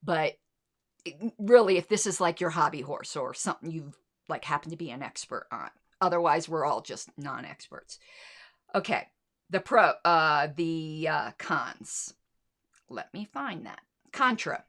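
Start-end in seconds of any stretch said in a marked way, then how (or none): none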